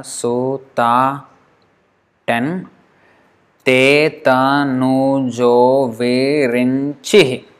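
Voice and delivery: each syllable spoken separately; counting syllables one by one. Shown as a demonstration. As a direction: tap out every syllable. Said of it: "So let us count".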